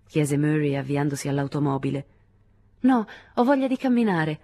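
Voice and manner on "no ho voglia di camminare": quick, bright and lively refusal; brisk reply. A narrator's reading. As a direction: toss it off eagerly. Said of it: "dissi vivamente".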